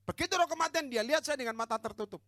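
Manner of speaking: high, loud dramatic testimony; full-force preaching. Retold or imitated. Preacher telling church of a deliverance, full-force preaching. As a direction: tell it like a fired-up preacher.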